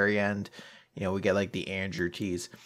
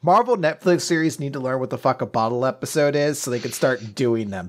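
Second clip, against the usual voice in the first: normal talking voice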